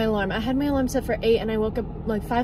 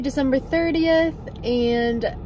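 normal talking voice

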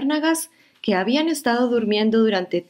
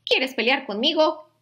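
higher, grumpy little bug voice; grouchy ladybug; 8 semitones higher than usual. Acted. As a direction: taunt it like a cranky ladybug. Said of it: "le dijo la mariquita malhumorada".

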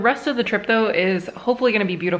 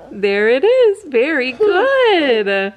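slow, drawn-out praise; proud parent praise; 4 semitones higher, 5 dB louder, much slower than usual. Real, not acted. Praising toddler showing ankle.

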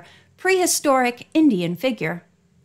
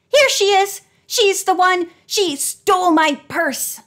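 much higher, louder accusing shriek; 9 semitones higher, 4 dB louder than usual. A narrator's reading. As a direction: scream the accusation at the girl. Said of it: "she shrieked".

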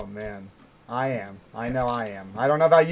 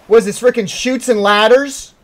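loud, higher mock outrage; indignant outrage; 8 semitones higher, 8 dB louder than usual. Real, not acted. Streamer loudly complaining about the game's slide.

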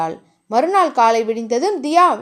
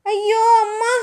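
wailing cry; 8 semitones higher, slower than usual. A narrator's reading. Woman lands on the floor, wailing. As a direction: cry out high in pain, contained. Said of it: "என்று தியா அலறவும்".

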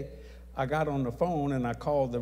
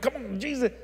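screaming; 10 semitones higher than usual. Retold or imitated. Preacher by the roadside pleading with Jesus, mild scream. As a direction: demand it of Jesus, worked up. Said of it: "I spit and screamed".

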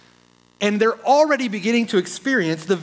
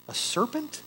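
soft wondering question; 5 semitones higher, 9 dB quieter than usual. Real, not acted. Incredulous hushed question.

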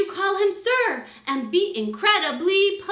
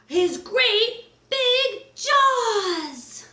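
big slow louder jaws line; dramatic reveal; 8 semitones higher, 4 dB louder, much slower than usual. Acted. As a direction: drag out the jaws for a scare. Lion opening jaws climax.

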